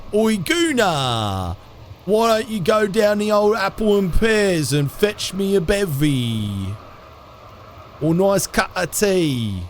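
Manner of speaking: high, hammed-up Cockney-style British accent; terrible British accent. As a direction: call out like a caricature Brit, British accent laid on thick. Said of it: "my own terrible accents".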